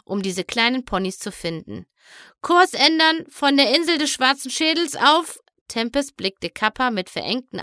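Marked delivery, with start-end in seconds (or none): 2.4-5.4 high, loud order to change course; commanding shout. Acted. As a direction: bark the command like a ship's captain. Tempest barking a course change.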